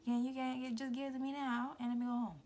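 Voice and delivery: loud, high impatient outburst; exasperated demand. Retold or imitated. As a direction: demand it loudly, fed up. Retold impatient demand for gift.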